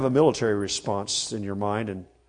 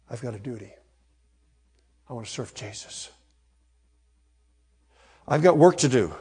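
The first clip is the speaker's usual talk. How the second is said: inner resolve; faster than usual. Retold or imitated. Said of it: "and I say, as it were".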